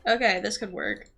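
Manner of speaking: ordinary delivery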